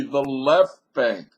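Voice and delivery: "the left bank" slow, weight on "left"; corrected. Real, not acted. Vlogger fixing his slip, stressing "left bank". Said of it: "excuse me".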